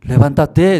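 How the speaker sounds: loud, high call to wake up. Retold or imitated. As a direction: call her awake. Voice calling loudly.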